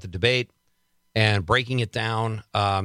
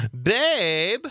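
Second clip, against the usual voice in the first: high, drawn-out mock whine of a husband; 8 semitones higher than usual. Comedian mocking husbands in couples' videos, mock husband. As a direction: imitate a husband's exasperated cry, higher pitched. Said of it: "the wife makes a sassy remark and he goes".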